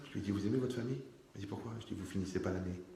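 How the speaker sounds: plus bas, menace sourde; menace à voix basse. Retold or imitated. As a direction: une menace glissée tout doucement. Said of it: "Je l'ai regardé comme ça". (menace pince-sans-rire, plus doucement)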